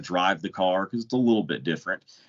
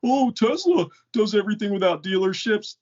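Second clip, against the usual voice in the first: sneering mock fanboy voice, much higher; mocking fans; 4 dB louder than usual. Mocking fans who cheer dealer-free Tesla, high sneering parody. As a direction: parody a smug Tesla fan. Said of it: "everybody jumps on and goes".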